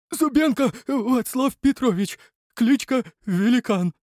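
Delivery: high slow frightened voice; scared answer. Acted. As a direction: stammer out your name in fear. Перепуганный главарь называет себя. (испуганный ответ)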